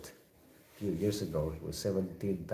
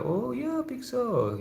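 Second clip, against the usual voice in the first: excited. Real, not acted. Cheerful reply to a chat guest.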